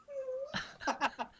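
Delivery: very high mock giggle; a silly falsetto giggle; hammed-up boyish giggle. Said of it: "He giggled".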